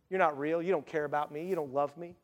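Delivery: low, quiet accusation; resentful complaint. Acted. A disheartened believer accusing God, low resentful complaint. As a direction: mutter a doubter's complaint, low and soft.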